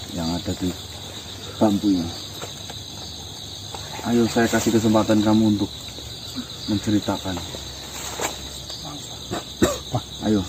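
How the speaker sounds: low, quiet spirit summoning; solemn incantation. Acted.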